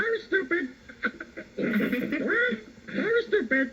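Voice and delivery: very high squawking parrot. Shown as a demonstration. Showing Tyra how a parrot talks, high squawky parrot voice. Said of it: "Like that".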